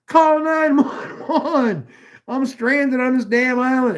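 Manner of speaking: put-on yelling to the old lady across the water, laughing; mock yell, laughing. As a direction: shout it across the river, cracking up. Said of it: "I'm yelling".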